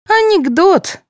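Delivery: high-pitched scoff; mocking echo. A narrator's reading. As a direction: chime in with the same derision. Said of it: "в тон ей добавила Вера".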